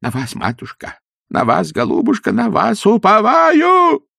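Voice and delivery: grovel and wail the prayer; fawning priest. Acted. Kneeling priest begging the lady of the house. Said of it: "поклонился в ноги и зачастил".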